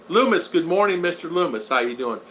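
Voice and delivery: ordinary delivery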